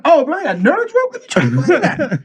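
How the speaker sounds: high-pitched eager delight; excited retelling. Retold or imitated. Friend offered edible candy, excitedly accepting.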